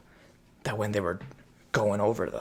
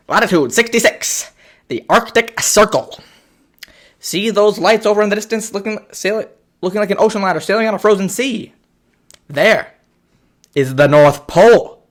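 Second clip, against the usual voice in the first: mock conductor announcement; 5 semitones higher, 8 dB louder than usual. Conductor's announcement in retold film.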